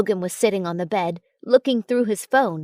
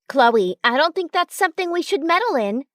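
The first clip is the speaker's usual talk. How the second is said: scolding; 7 semitones higher than usual. A narrator's reading. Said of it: "Claire scolded".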